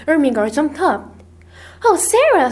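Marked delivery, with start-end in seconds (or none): 1.8-2.5 high-pitched exclamation; dismayed cry. A narrator's reading. Ermengarde jumps up for hungry Sarah, crying out. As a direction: cry out to a dear friend. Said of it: "she cried".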